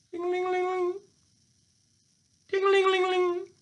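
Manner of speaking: very high ringing ding-a-ling; high singsong tinkling; imitated distant bell. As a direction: trill it like a little alarm bell.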